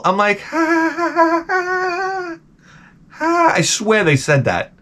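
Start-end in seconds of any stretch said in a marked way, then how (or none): 0.4-3.5 high, strained falsetto ha-ha; fake laugh. Guest asked if he likes [name], forced laughter. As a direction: drag out a forced polite laugh.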